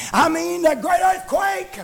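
high, driving revival cadence; fervent preaching. Real, not acted. Preacher urging people to find an altar, fervent preaching. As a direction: preach urgently, stretching the key words.

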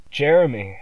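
loud, high call of his son's name; dad bursting in, calling out loud; father bursts into the room, shouting his son's name; cried out. A narrator's reading. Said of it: "my dad cried".

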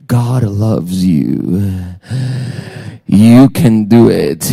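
very slow, low, drawn-out reassurance; mock soothing. Pastor mocks what churchgoers want to hear, slow soothing. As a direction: stretch out each word soothingly, low. Mimics sermons people prefer hearing.

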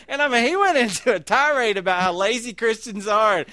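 can't stop chuckling at the memory; laughing while telling. Real, not acted. Pastor amused telling his congregation the story, laughing.

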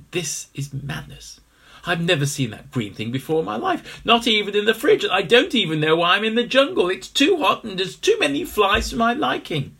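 high, loud, fast grumbling; rising complaint. Acted. Henry complains.

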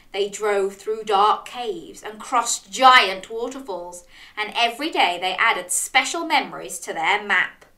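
plain reading voice

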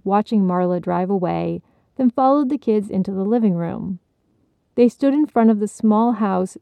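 ordinary delivery, nothing marked

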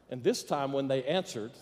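ordinary delivery

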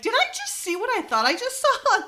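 high, incredulous double-take; disbelieving squeak at what you just saw; mock stunned question; shocked disbelief. Retelling own shocked reaction.

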